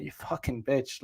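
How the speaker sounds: low, soft muttered curse under the breath. Retold or imitated. Walking away from the rude girl, muttering. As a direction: mutter it as you walk off. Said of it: "kinda muttered it".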